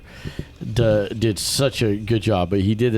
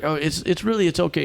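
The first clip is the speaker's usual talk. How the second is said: mock reassuring; 9 semitones higher than usual. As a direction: coax a frightened child gently. Teacher voiced reassuring scared girl.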